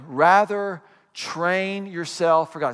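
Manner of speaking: very slow, stress on "Rather" and "train"; slow emphasis. Shown as a demonstration. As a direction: spell out "Rather train yourself" word by word. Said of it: "look at these next five words".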